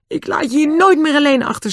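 high, distressed promise; fervent vow. A narrator's reading. Owner promises dog never to leave it, high fervent cry. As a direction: pitch well up, emotional and emphatic.

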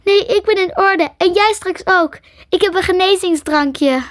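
high, urgent insistence; frantic reassurance. A narrator's reading. Ellen to dying Crystal, offering a potion, frantic.

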